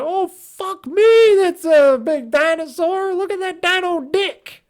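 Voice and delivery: high, loud, slow hammed-up diva voice. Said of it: "She'd be like".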